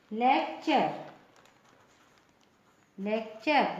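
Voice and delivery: "लेक्चर" ending in char; "लेक्चर" modelled with a clear ch; TURE as "char". Shown as a demonstration. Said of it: "लेक्टर नहीं, लेक्चर".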